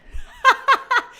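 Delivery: high sudden laugh; burst of laughter. Real, not acted. Laughing at Marvel's mess. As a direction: cracks up at it.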